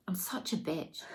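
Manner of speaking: a bitter little whisper under the breath; self-directed whisper. Real, not acted. Alone on camera, angry at herself, whispered. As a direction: whisper it to yourself, fed up. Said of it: "starting to feel really angry at myself".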